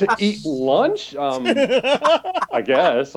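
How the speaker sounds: high, very slow bewildered answer; stall, completely thrown; baffled high reply. Retold or imitated. Fan answers Avery Brooks about lunch, baffled and slow.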